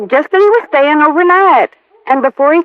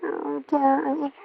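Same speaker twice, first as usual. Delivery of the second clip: tender confession; 13 dB quieter than usual. Acted.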